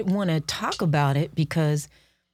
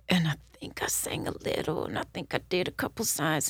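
hushed; 7 dB quieter than usual. Real, not acted.

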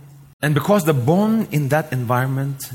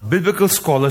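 usual speaking voice

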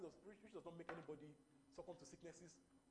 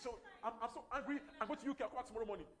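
higher, louder, laughing fake outrage; mock rich tantrum; 4 semitones higher, 9 dB louder, faster than usual. Preacher acting a rich person storming off to the UK, hammed-up loud sulk. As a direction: ham up a spoiled rich sulk.

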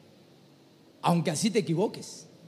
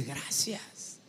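soft, low, weakened thank-you; faint thanks; 6 semitones lower, 11 dB quieter than usual. Acted. Overcome man thanking the one who prophesied, faint. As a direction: barely get the thanks out.

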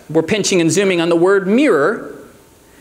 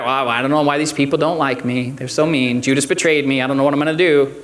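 a grumbler feeling sorry for himself; mock self-pitying whine; 7 semitones lower than usual. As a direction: sulk it out, half in jest. Mock complaint Jesus never made.

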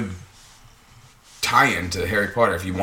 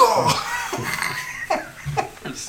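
burst of laughter; 8 semitones higher, 6 dB quieter than usual. Real, not acted. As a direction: crack up laughing. Laughs after the spell.

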